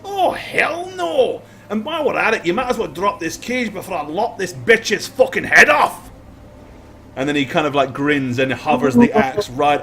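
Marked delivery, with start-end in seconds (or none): 0.0-6.1 high, yelled defiance that starts on a leap up; furious outburst. Acted. Prisoner roaring at the party holding him, furious. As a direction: snarl it at the captors like a cornered brute.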